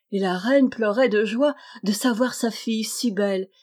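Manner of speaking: plain reading voice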